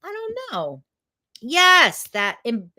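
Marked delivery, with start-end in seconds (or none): 0.0-0.8 light, high, soft; high shrug. Real, not acted. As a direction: toss it off with a high lilt. Playful shrug repeated.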